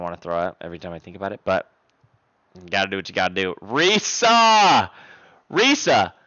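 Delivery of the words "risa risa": loud, high, drawn-out name shout; excited shout. Real, not acted. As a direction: holler the name in amazement at a gift. Hyped reaction to a gift.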